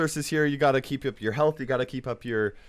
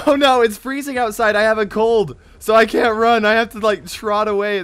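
higher, louder fleeing babble; panicked complaint; 5 semitones higher, 6 dB louder than usual. Real, not acted. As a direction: wail it while trying to get away. Fleeing a charging monster.

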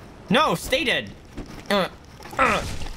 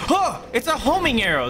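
surprised; 10 semitones higher than usual. Real, not acted. Surprised by homing arrow.

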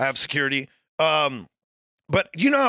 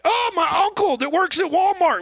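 very high mock-wailing complaint; hammed-up imitation of someone moaning; mocking whine; 12 semitones higher, 3 dB louder than usual. Imitating people upset by their uncle, mocking high wail.

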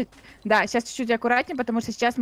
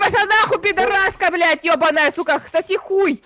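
furious shouting, louder and higher; angry yelling; 7 semitones higher, 6 dB louder than usual. Real, not acted. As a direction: hurl curses at the enemy. Cursing at an enemy player.

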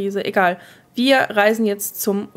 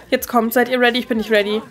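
ordinary talk

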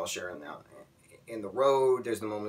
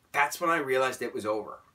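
ordinary delivery

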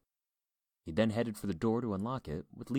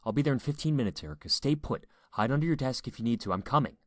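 raised, louder urgent voice; 6 semitones higher, 6 dB louder than usual. A narrator's reading. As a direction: give firm, pressing orders to your wife. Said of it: "He felt the urgency to react".

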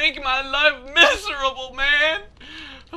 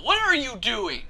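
usual speaking voice

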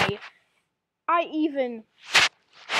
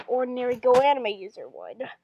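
plain reading voice

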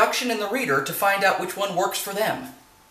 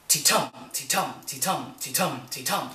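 "Ti-tum" with a soft ti and a stressed tum, lower and softer; heartbeat rhythm; 4 semitones lower, 5 dB quieter than usual. Shown as a demonstration. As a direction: tap out the iambic beat like a heartbeat, ti soft, tum strong. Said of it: "just like a heartbeat".

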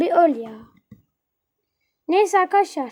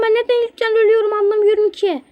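higher and louder, fed-up gamer; why do I always die first; exasperated complaint; 6 semitones higher, 5 dB louder than usual. Real, not acted. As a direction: vent the annoyance outright. Keeps dying first, complains.

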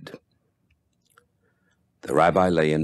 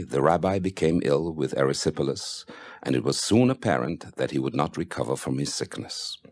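ordinary delivery, nothing marked

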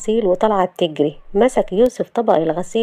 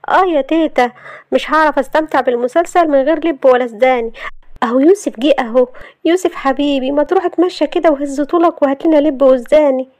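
higher, cheeky heroine voice; carefree Shahd; 7 semitones higher than usual. Acted. Shahd asking stunned family for snacks, cheeky and high. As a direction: pester them breezily, pitched up.

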